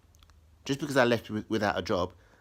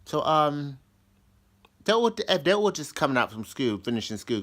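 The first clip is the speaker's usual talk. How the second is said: ordinary talk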